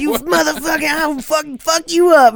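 high, hammed-up snarling threat; mock furious threat. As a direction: growl it like a cornered vampire. Vampire retold threatening her.

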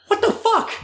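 high-pitched incredulous outburst, half laughing; baffled disbelief. Retold or imitated. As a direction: act out being floored by strangers' friendliness. Retells reaction to strangers' compliments.

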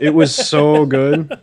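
slow, laughing fake praise; sarcastic. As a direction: gush with obvious sarcasm. Sarcastic praise of hated movie.

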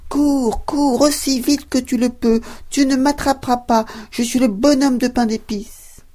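high, loud sing-song taunt; cheeky cookie shouting back over his shoulder; gingerbread man taunting. Acted. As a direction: tease them from a safe distance, high and bright. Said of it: "lui dit".

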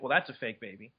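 low, quiet offhand quip; half-joking. Retold or imitated. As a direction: toss it off softly as a half-joke. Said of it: "I half jokingly".

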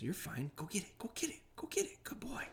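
high, hushed, rising coaxing cheer to a dog; soccer dad cheer. Retold or imitated. Soccer dad trainer hyping up his dog, high hushed cheering. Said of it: "soccer dad comes in and goes".

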